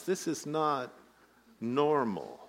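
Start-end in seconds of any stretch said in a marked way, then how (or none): none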